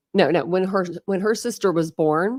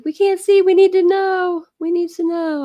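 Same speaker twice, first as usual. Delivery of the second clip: excited; 12 semitones higher, 6 dB louder than usual. Real, not acted. Excited about baby photo.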